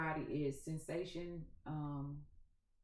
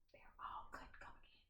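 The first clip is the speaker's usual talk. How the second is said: hushed conspiratorial whisper; secret whisper; 16 dB quieter, faster than usual. Pretend. Gardener sharing a secret with her viewers, whispered. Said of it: "Shh, don't tell nobody".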